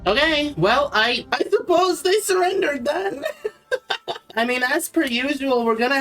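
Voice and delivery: high amused disbelief; surprised laugh. Real, not acted. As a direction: giggle at the surprise surrender. Enemy surrenders unexpectedly, he laughs.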